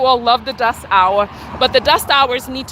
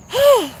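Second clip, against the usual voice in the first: high exaggerated gasp; high mock gasp; 12 semitones higher, 3 dB louder than usual. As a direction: gasp like a shocked viewer. Mocking gasp.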